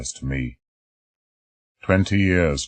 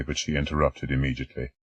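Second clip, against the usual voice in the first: ordinary delivery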